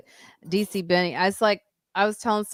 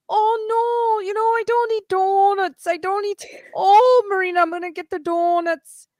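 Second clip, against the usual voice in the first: much higher, mock-whiny friend's voice with a crying edge; imitating Lois; 13 semitones higher, 4 dB louder than usual. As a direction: squeak out a fake protest about donuts. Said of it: "Lois was like".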